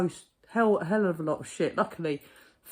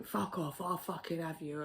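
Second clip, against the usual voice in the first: ordinary talk, nothing marked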